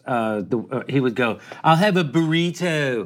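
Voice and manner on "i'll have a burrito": slow, mocking American accent. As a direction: imitate an American's accent, drawn out. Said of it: "make fun of us".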